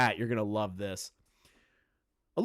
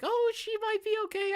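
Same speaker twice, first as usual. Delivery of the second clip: much higher, falsetto mock innocence; mock naive voice. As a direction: squeak it out like a fake-hopeful bit. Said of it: "I'm not gonna do a bit here and be like".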